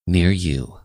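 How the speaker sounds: ordinary talk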